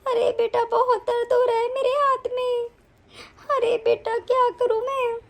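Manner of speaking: wailing, sobbing complaint; crying. Acted. Mother complaining to her son about her twisted hand, crying. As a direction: sob pitifully through the words. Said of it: "आप यहाँ बैठ के क्यों रो रही हैं".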